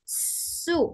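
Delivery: सु breathed out with no voice; airy su demo; whispered su. Shown as a demonstration. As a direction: let su be pure air. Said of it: "सीटी वाला है".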